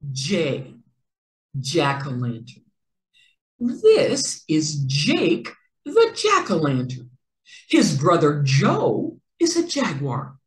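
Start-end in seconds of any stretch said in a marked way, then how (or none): none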